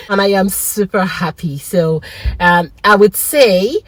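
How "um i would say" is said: ordinary talk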